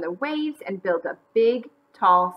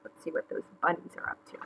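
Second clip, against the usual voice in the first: quick, whispery, low aside; hushed aside; 4 semitones lower, 11 dB quieter, faster than usual. Acted. Narrator peeking at the bunnies, hushed aside.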